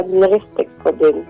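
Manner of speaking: ordinary delivery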